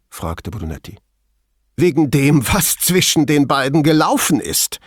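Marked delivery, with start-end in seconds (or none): 1.8-4.8 high, loud, indignant retort; snorting indignation. A narrator's reading. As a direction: snort it out crossly. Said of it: "schnaubte Veltrini". (empört schnaubend)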